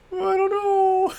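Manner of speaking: "know" stretched very high and slow; silly squeaky voice. Shown as a demonstration.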